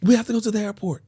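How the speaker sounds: high, quick woman's answer; high-pitched mock girlfriend; imitating woman friend. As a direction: say it briskly in her high voice. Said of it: "I'm like".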